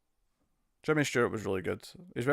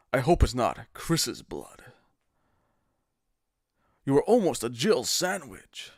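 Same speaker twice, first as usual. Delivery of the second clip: bad game voice acting. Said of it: "Resident Evil one, bad voice acting".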